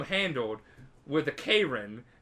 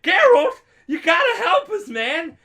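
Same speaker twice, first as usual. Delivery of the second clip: much higher, loud panicked begging; desperate plea; 12 dB louder than usual. Retold or imitated. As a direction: yelp for help from the noose. Dandelion begging from the gallows.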